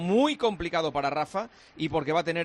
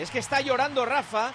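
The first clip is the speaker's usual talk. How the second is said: emoción real, con voz muy aguda; cuéntalo conmovido, con el tono arriba; asombro emocionado; 7 semitones higher than usual. Real, not acted. Asombro real ante Nadal llorando. (asombro emocionado, voz aguda)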